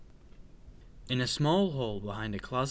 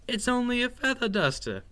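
higher-pitched chiding sister; dismissive reassurance; 10 semitones higher than usual. A narrator's reading. Sister mouse explains the feather duster, chiding. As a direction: wave away the scare in a raised pitch.

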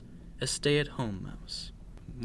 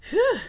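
high, loud "whew" of relief; relieved sigh; 9 dB louder than usual. A narrator's reading. Little mouse after a false alarm, relieved sigh. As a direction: let out a squeaky relieved sigh. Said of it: "sighed Squeak".

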